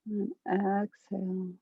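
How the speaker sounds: calm meditation guide, long pauses between phrases; guided meditation. Acted. Guide settling a group into a body scan, calm guided meditation. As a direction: lead them gently through breath and body, unhurried.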